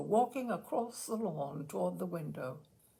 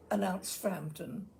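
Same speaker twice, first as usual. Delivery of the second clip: ordinary delivery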